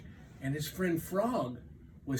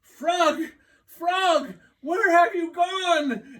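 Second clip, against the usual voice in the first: frantic shout; 12 dB louder, slower than usual. Acted. Toad shouting for lost Frog.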